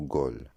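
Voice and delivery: gaule with a hard G; wrong pronunciation. Shown as a demonstration. Teacher showing a second mistake, gaule with hard G. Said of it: "et non".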